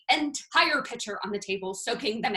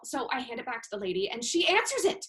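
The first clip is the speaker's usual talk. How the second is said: ordinary talk, nothing marked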